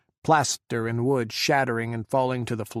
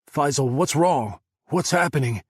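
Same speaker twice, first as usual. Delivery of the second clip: higher, louder, rising alarm; 4 semitones higher, 7 dB louder than usual. Acted. Adam on the phone to a dying Faisal, alarmed and louder. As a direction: demand answers in a sudden fright. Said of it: "The steel in Adam's voice wavered".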